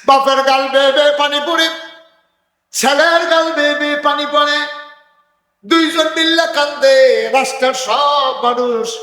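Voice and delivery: high, loud chanted sermon cry; full-throated waz preaching; preaching at full force. Acted. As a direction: ring it out over the crowd. Tearful reunion chanted in sermon.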